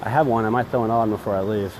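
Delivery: usual speaking voice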